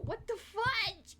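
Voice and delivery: very high, drawn-out squeal of disbelief; exasperated outburst. Real, not acted. Streamer fighting Blender, exasperated high squeal. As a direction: squeal it slowly, utterly fed up.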